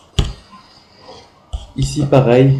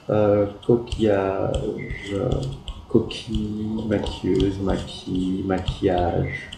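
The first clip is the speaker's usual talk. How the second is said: slow dictation; 4 dB quieter, slower than usual. Shown as a demonstration. Slow read of word list.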